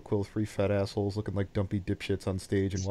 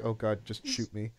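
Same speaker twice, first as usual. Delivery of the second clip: ordinary talk, nothing marked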